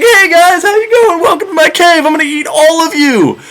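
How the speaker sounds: high, bright mock-friendly giant greeting; hammed-up high cyclops welcoming his dinner; cyclops host. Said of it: "and he's like".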